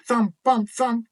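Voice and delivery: ordinary delivery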